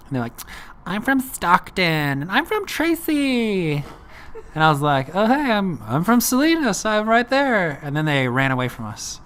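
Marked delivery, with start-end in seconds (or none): 0.9-3.8 put-on high, slow, drawn-out girly answer; two girls reply to his Spanish in a high, slow girly voice; mock valley girl voice. Girls answer in perfect English. 5.1-7.8 high, bright, eager reply; he tells the girls he is from nearby, high and eager; eager excited. Retold or imitated. Eagerly tells girls he's local.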